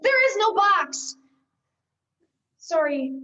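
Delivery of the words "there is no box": loud, high, slow shout; screaming there is no box; exasperated scream. Acted. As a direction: lose patience and yell. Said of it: "You screamed so loud".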